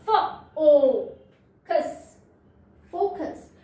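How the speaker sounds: फ, loud ओ, then फोकस; syllable split. Shown as a demonstration. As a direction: sound out फोकस piece by piece. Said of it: "syllable division".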